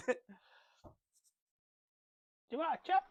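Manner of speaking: high mock mum offering chips; imitating mum. Comedian imitates his mum offering chips to everyone. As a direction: lift the voice like an eager mum.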